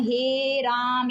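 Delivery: हे राम with the last vowel stretched very long; a slow, long-held calling out of हे राम; drawn-out call. Shown as a demonstration. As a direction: call हे राम from afar, pulling out the final vowel. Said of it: "जैसे किसी को अगर बुलाते हैं".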